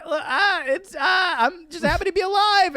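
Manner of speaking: hammed-up blubbering plea; mock sobbing. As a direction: recruit fake-crying to the drill sergeant. Private pleading with the drill sergeant.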